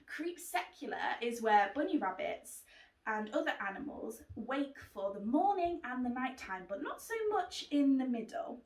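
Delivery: plain reading voice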